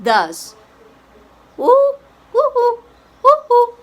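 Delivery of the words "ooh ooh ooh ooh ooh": high, short owl hoots; high-pitched owl calls. Shown as a demonstration. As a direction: hoot high in quick pairs. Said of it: "The owl does".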